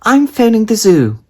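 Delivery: high, loud firm mother; determined mum. A narrator's reading. Mum deciding to call the zoo, high and loud.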